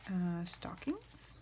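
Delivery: a slow whisper. Real, not acted. Vlogger whispering a label while flipping pages, hushed. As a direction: whisper the word slowly to herself.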